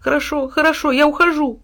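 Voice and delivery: high dog voice, sheepish and backing off; lightly voiced dog, slinking away; ashamed dog. A narrator's reading. Dog giving in to his angry master. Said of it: "пристыженно поджал хвост пёс".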